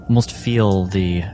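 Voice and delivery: ordinary talk, nothing marked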